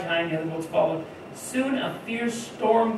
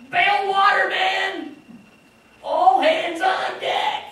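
high, loud yelling of orders; frantic deckhand bellowing commands; panicked shout; 10 semitones higher, 4 dB louder, much slower than usual. Retold or imitated. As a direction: shout over the storm for all hands. Disciples want Jesus to help bail.

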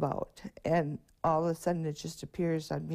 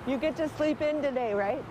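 playful teasing call; 9 semitones higher, 3 dB louder than usual. Real, not acted. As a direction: tease them kindly across the street. Teasing kids at crossing.

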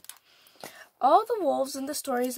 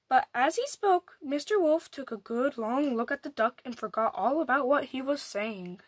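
plain reading voice

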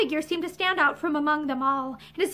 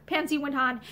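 plain reading voice

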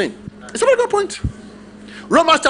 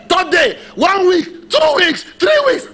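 mock building exclamation; 10 semitones higher, slower than usual. Mocking weeks of begging, rising count of days.

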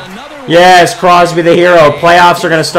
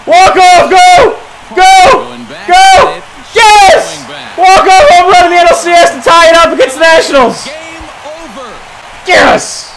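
ecstatic shouting; 11 semitones higher than usual. Real, not acted. Gamer yelling after a playoff walk-off homer. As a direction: lose it over the walk-off. Walk-off home run celebration.

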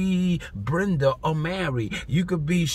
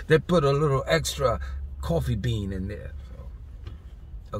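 ordinary talk

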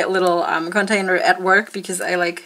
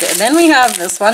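ordinary talk, nothing marked

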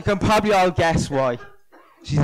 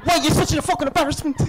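scolding mother; 11 semitones higher, faster than usual. Retold or imitated. Mother scolding him at home.

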